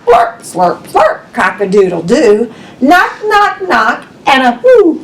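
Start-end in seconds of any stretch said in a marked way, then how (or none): none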